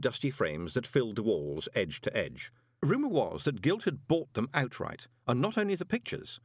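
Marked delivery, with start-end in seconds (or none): none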